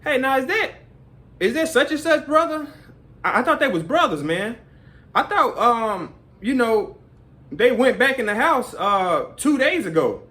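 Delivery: high, puzzled mock voice; confused questioner. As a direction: play the clueless viewer asking questions.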